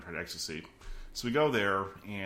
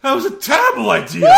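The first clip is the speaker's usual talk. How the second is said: panicked shout; 19 dB louder than usual. Retold or imitated. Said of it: "He's like".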